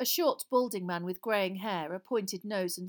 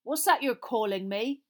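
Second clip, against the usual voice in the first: louder, higher defiant challenge; 4 semitones higher, 7 dB louder than usual. A narrator's reading. Frank answering the unseen voice in the chair, defiant. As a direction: challenge him, refusing to be cowed. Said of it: "said Frank defiantly".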